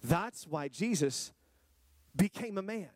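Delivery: normal talking voice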